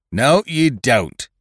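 loud, high, drawn-out angry refusal; angry cry. A narrator's reading. He turns on two men who would desert, crying out angrily. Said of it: "he turned on them angrily".

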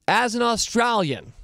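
a bad stab at an Australian accent; half-hearted Australian accent; lame Aussie accent. Shown as a demonstration. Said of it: "start affecting my lame Aussie accent".

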